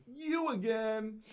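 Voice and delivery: high, drawn-out heckle; mock jeer. Someone jeering as the winner gets up, drawn-out mock heckle. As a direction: imitate an exasperated heckler, stretching each word.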